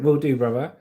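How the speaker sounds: ordinary delivery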